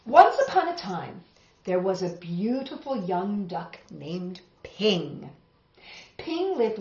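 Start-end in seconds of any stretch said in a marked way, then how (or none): none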